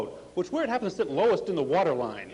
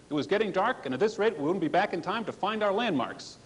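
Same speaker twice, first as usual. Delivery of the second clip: normal talking voice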